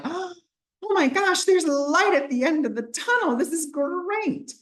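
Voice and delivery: thrilled squeal of hope, high and bright with relief; overjoyed relief. Retold or imitated. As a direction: gush with wide-eyed joy like you just saw the light. Retelling her own moment of relief.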